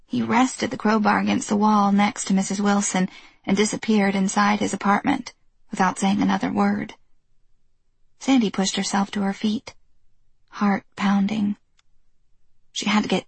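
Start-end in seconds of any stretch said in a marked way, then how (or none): none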